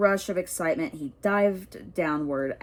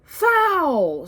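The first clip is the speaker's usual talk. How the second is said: high, loud crowd shout; screamed; 10 semitones higher, 8 dB louder than usual. Acted. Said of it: "screamed the Gryffindors".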